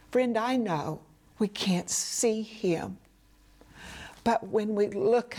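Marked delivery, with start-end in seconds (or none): none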